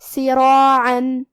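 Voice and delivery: سراعن with a full, heavy ra and a long heavy alif, slowly chanted. Shown as a demonstration. Teacher shows tafkheem to students, slow heavy chant. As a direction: round the mouth on ra in سراعن, draw it out. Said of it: "مد الف کو بھی بھاری آواز میں ادا کریں گے".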